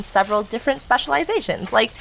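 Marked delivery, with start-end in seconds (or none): none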